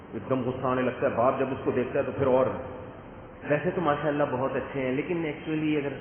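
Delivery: ordinary delivery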